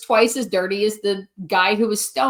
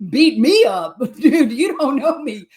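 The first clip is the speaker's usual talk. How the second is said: laughing mock threat. To the comic who bombed the intro, laughing mock threat. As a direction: fire back, laughing through it. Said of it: "I'm like".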